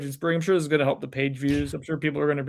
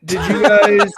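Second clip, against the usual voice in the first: laughing; 6 semitones higher, 10 dB louder, slower than usual. Real, not acted. Laughing at the tangent.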